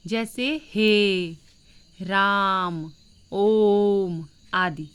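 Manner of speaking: हे, राम, ओम stretched very slowly, triple length; prolonged vowels. Shown as a demonstration. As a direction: drag out हे... राम... ओम like a call. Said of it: "तीन गुना समय लगता है".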